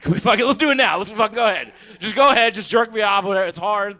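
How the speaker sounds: high, loud, rushed begging; frantic eager pleading. Retold or imitated. Comic begging the prostitute at gunpoint, high and loud. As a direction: push it out like a desperate, eager guy.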